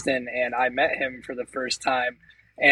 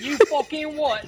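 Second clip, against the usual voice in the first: high, drawn-out mock Cockney; Cockney accent; 10 semitones higher than usual. Shown as a demonstration. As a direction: act out an incredulous Cockney. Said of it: "That's what they say".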